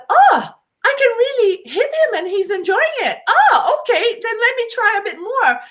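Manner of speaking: light up as it dawns on you; delighted surprise. Retold or imitated. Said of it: "they realize".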